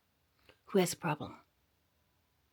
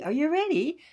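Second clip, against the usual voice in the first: ordinary delivery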